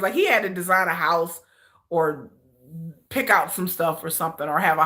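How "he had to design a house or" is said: ordinary talk, nothing marked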